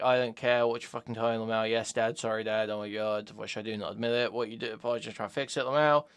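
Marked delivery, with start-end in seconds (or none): none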